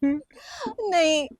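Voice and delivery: very soft, slow sobbing no; a broken, drawn-out no; tearful no. Acted. Wife refusing to go home, sobbing softly.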